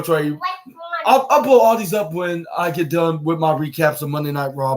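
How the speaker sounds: ordinary talk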